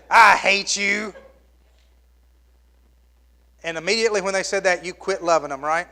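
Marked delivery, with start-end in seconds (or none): 0.1-1.1 put-on slow, loud bratty child voice; child's tantrum. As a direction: spit it out like a sulking kid. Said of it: "You ever had your child say".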